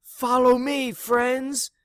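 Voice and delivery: high, loud rallying shout. Acted. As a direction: call the posse to follow. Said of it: "shouted Pig to his posse".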